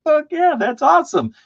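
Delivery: very high, loud whoop; excited cheer. Retold or imitated. Squealing with glee. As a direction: painter hearing a shipment is coming, high excited cheer. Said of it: "I'm like".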